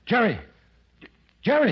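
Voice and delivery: high, loud cries of a name; shouted name. Acted. Woman rushing in, crying out the fallen man's name. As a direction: call out to him in alarm. Calling out to shot man.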